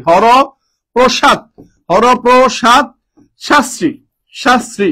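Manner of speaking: Haraprasad drawn out syllable by syllable, very slow; slowly spelled out. Shown as a demonstration. Teacher spelling the name Haraprasad for students, very slow. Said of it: "আবিষ্কার করেন হচ্ছে হরপ্রসাদ শাস্ত্রী".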